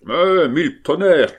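loud, high exasperated oath; angry carter's cry. A narrator's reading. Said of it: "cria le charretier".

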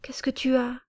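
put-on high, breathy little girl's whisper; child's whisper. Small girl whispering to the crying uncle. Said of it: "murmura Sanka".

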